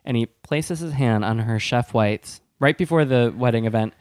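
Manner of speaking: ordinary delivery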